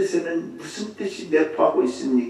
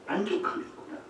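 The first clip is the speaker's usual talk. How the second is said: normal talking voice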